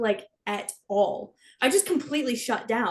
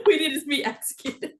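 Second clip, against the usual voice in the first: helpless laughter through the words; laughing repeat; 2 semitones higher than usual. Real, not acted. Friend repeating another's word mix-up, laughing hard. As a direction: crack up while repeating the slip.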